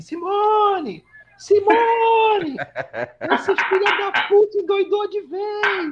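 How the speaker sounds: high, loud laughing mock scream, slowing at the end; screaming for Simone. Mother-in-law yelling for her daughter Simone about him, high laughing shout. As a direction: shriek her name across the house in disbelief. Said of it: "Ela gritava assim".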